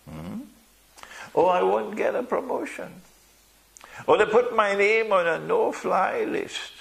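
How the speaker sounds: high-pitched, fretful excuse-maker; worried believer. Acted. Preacher voices a fearful, compromising believer.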